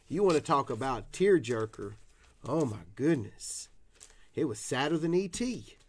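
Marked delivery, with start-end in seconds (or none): none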